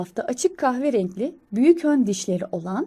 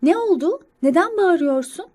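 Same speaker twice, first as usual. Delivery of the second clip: higher, louder questioning mother; mother squirrel; 7 semitones higher, 5 dB louder than usual. Acted. Unseen mother squirrel asking about the shouting, raised motherly voice. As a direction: call out the question as a puzzled mother. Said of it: "anne sincapın sesi olarak".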